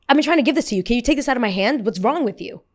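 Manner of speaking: high, loud indignant demand; angry rude passenger. Retold or imitated. Passenger scolding the flight attendant, high and loud. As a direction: play the entitled passenger snapping. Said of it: "She goes".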